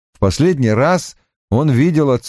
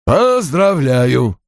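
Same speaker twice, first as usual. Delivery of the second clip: slow, higher-pitched grumpy secretary voice; grunted congratulations; 4 semitones higher, much slower than usual. A narrator's reading. Secretary grunts congratulations over clacking keys, grumpily. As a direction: grunt it out, dragging the word. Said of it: "буркнула Пат под стук клавиш".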